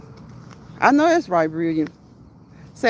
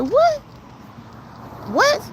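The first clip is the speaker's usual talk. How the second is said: retold disbelief. Host retelling her reaction to a troll, incredulous. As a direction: re-enact being taken aback, pitch shooting way up.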